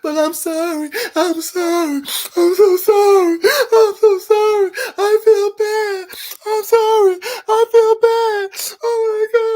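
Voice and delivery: sobbing falsetto imitation of her crying; mock crying apology. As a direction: ham up her tearful apology. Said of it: "She crying, snotting".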